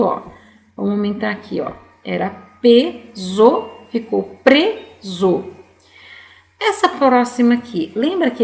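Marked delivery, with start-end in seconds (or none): 2.6-3.7 "peso" slowly split, pe... so; split into syllables. Shown as a demonstration. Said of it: "a primeira palavra era peso". 4.4-5.4 "preso" slowly split, pre... so; split into syllables. Shown as a demonstration. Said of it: "Quando entrou a letrinha R no meio".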